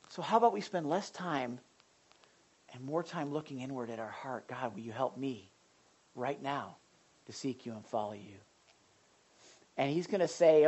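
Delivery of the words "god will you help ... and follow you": low, hushed prayer; lowered voice, humbly asking God; soft prayer. Acted. Modelling a personal prayer.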